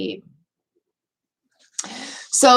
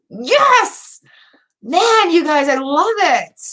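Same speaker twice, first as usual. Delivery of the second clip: a high, loud yes squeaked out; excited squeal; 6 semitones higher, 3 dB louder, much slower than usual. Real, not acted. Reader cheering on Charlie Bear's faith, a squealed yes. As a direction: squeal yes like a happy fan.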